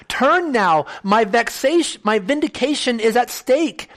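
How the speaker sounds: a man begging his friends to turn back; impassioned plea. Acted. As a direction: plead Turn now with rising force. Impassioned reading of Job's plea.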